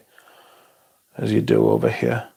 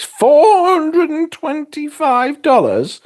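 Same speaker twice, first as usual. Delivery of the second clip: much higher, slow, louder disbelief; shocked at the price; 4 dB louder, slower than usual. Real, not acted. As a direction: gasp out the price in outrage.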